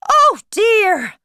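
high, loud storybook dismay; sad. A narrator's reading. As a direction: lament it loudly in character. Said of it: "March Hare said sadly".

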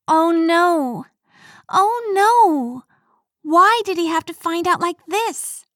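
high, loud panicked cry. A narrator's reading. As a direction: caught out, panicking inside. Panicked inner thought.

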